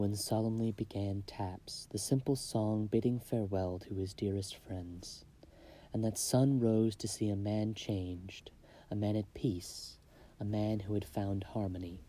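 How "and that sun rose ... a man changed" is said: usual speaking voice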